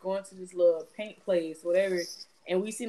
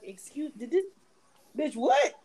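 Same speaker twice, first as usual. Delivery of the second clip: stunned disbelief; 6 semitones higher than usual. Retold or imitated. Reacting to friend's sudden adoption.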